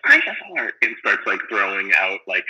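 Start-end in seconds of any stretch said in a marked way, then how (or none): 0.0-0.7 put-on high, louder nerdy-kid outburst; mock scream. Retelling a super nerdy kid's outburst, mock scream. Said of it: "he just screams".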